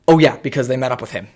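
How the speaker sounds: ordinary talk, nothing marked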